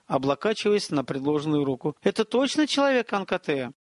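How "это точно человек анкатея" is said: higher, lightly voiced lady; a hint of a worried lady; woman's voice. A narrator's reading. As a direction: ask in a raised female register. Veretta questions Viktor.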